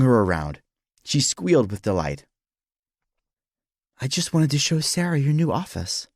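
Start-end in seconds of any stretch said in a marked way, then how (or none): 4.0-6.1 higher-pitched woman's voice; wife's voice. A narrator's reading. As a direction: voice the wife explaining her visit. Wife Rebecca speaks in story.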